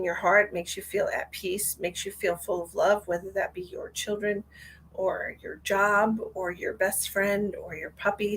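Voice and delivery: guided meditation. Acted. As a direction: guide listeners gently into their heart. Leads a short love meditation.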